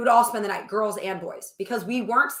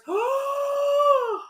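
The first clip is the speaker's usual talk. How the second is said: long high gasp of excitement; delighted gasp. Real, not acted. Describing the collaborative game, delighted gasp. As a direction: gasp at how fun it was.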